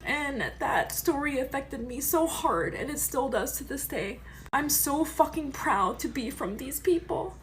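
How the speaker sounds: high, tearful real voice; emotional. Real, not acted. Comedian moved by family's love for the dead uncle, really tearful and high. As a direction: push the words out through welling tears, pitched high. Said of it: "when I get emotional".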